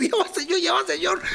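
mock-terrified high plea; terrified squeak. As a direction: beg the robber in a frightened high voice. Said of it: "estaba tan asustado".

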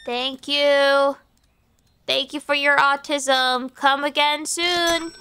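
ordinary talk, nothing marked

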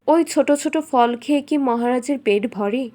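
usual speaking voice

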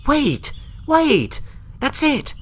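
high, excited cry of discovery; light up as the answer hits you; sudden realisation. Acted. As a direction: eureka shout.

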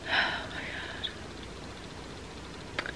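exasperated whisper; whispered disbelief. Real, not acted. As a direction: breathe it out unvoiced.

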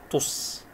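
تص held on a hissed s, "tuss"; closed syllable. Shown as a demonstration. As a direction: end تص on a vowelless s. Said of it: "مقطع ساكن".

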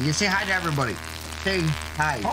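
ordinary talk, nothing marked

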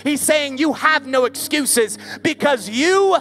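full-force preaching. Acted. Preacher thundering to a night crowd, full force. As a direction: preach it to the back row with fire.

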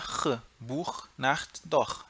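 "R" as a back-of-throat ch, then "Buch", "Nacht", "Doch"; throaty German ch. Shown as a demonstration. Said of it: "você vai ter que falar R". ("Buch", "Nacht", "Doch" com ch de R na garganta)

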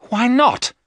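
high, loud angry outburst; angry cry. Acted. Jonathan crying out angrily at the professor. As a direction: fling it back in protest. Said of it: "Jonathan cried angrily".

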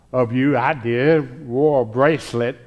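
usual speaking voice

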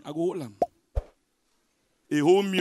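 ordinary delivery

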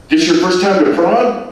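bubbly Scouse tourist chatting up a stranger; Liverpool man. Acted. Liverpool man asks Mike.

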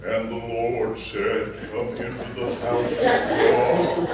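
slow, low, solemn pulpit intonation; hammed-up holy preacher drawl; mock preacher voice. Mocking the typical preacher, a slow solemn voice. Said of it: "I'm not this".

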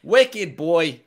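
put-on loud Boston accent, high and brash; wicked boy, Boston style. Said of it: "Boston boy".

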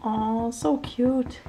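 soft, high cooing. Real, not acted. Melting over a cute animal in soft, high baby talk.

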